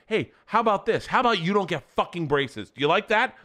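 high-pitched mock threat; old-school aggressive self. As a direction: play your old hot-headed self. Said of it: "Old school Bert would have been like".

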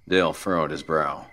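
plain reading voice

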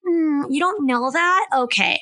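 put-on high, slow condescending valley girl drawl. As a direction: talk down like a snooty valley girl. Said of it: "Look, now I'm doing Valley Girl".

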